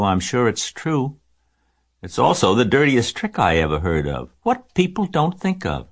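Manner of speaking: measured, high voice of a sure-minded spider; composed spider Charlotte. A narrator's reading. Spider confirms sheep's warning to pig, firm and considered.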